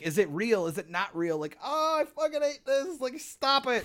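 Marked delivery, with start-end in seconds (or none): 1.6-3.9 high, strained, fretful complaint; mock dread. A player losing trust in their own mind, high strained dread. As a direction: whine like the fear is too much.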